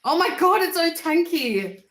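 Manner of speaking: loud, high thrilled shout at seeing a fan; excited recognition. Real, not acted. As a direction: burst out with delight. Spots a fan in chat.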